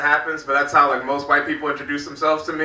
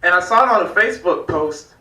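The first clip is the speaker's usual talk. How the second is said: normal talking voice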